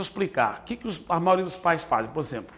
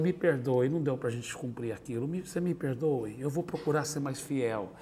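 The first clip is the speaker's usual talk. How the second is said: put-on soft, lower, sincere apology to a child; gentle apology; 5 semitones lower, 8 dB quieter than usual. Father asking his son for forgiveness, soft and sincere. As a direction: quietly ask the son's forgiveness.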